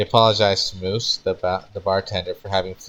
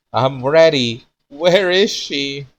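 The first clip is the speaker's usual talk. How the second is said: crying out; 7 semitones higher, 6 dB louder, slower than usual. Acted. Crying out to the heavens.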